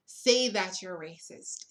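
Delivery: normal talking voice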